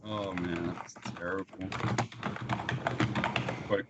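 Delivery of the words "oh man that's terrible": ordinary delivery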